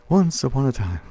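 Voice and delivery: bedtime story. Pretend. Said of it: "In my bedtime voice".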